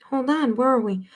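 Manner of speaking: ordinary delivery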